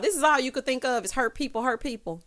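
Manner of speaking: usual speaking voice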